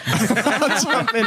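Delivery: slow, laughing through the words. Real, not acted. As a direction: giggle it out slowly. Repeats line laughing.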